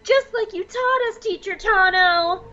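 high cartoonish child; kid voice. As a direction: squeak it out like a proud kid. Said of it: "And Corky is like".

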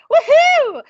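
high, loud whoop; celebratory cheer. Real, not acted. Cheers the milestone episode.

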